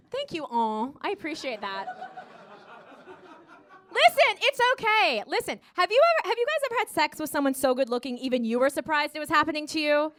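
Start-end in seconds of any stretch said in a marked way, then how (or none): none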